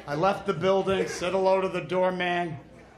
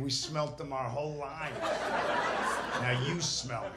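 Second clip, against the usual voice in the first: softer, hushed mock reply; kids' quiet voice; 4 semitones lower, 6 dB quieter than usual. Kids in the pictures answering him, soft and hushed. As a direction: murmur it like kids in a photo. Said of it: "As if they were saying".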